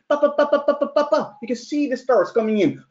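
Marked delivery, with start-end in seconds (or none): 0.1-1.4 rapid higher ba-ba-ba bursts like gunfire; machine gun imitation. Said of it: "like a machine gun".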